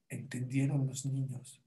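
ordinary delivery

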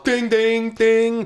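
high, ringing ding; sung-out clanging bell noise; imitated bell. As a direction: loudly imitate a big handbell. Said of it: "she rings a big bell".

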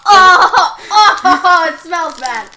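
loud, high grossed-out cry; disgusted recoil. Real, not acted. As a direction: recoil from a bad smell. Strong smell, recoiling.

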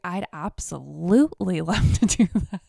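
loud, gleeful yes with laughter; big-smile delight. Retold or imitated. Dominatrix accepts the couple's request, delighted and smiling. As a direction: gush it through a big smile, punching the adverb. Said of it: "she had the biggest smile on her face".

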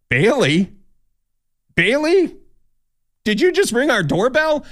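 high, incredulous calls of the dog's name; puzzled calling out. Retold or imitated. Shelter crew calling to the dog at their door, incredulous and high. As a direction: call out to the dog in disbelief.

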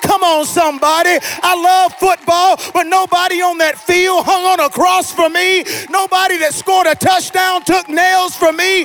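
impassioned pulpit crescendo; fervent, mounting sermon cry; full-force preaching. Real, not acted. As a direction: whip the congregation up into praise.

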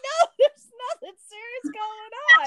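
high, laughing brush-off; laughing retort. Retold or imitated. Laughing off girlfriend's rant, high and carefree. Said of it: "And I'm like".

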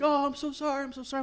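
put-on high, pleading little boy; sorry little boy. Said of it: "He's like".

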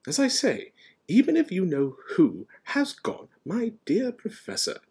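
high-pitched, insistent professor; character dialogue. A narrator's reading. Professors resuming their debate, lightly insistent.

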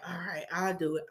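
low, soft giving in; resigned, dropped-voice reply; reluctant agreement. Retold or imitated. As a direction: give in quietly and unwillingly. Giving in while sick.

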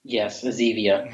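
thin, tinny speakerphone sound; talking through a bad mic. Real, not acted. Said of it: "since you sound like crap".

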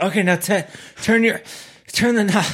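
gasping out instructions mid-ride; breathless, high and strained; out of breath. Pretend. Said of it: "I'd be like".

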